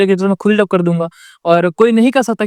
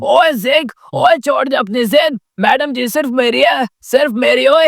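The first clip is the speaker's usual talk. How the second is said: higher, slow, tough hero threat; Sunny Deol voice; 5 semitones higher than usual. Retold or imitated. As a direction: bark it like an action star. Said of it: "जैसा सनी देओल का आवाज है".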